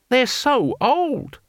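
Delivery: very high, drawn-out disbelief; amazed disbelief. A narrator's reading. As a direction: stretch it out, high and incredulous. Taxman amazed at the old fighters.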